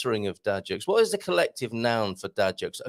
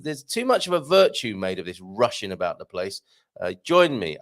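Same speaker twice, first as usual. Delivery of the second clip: ordinary talk, nothing marked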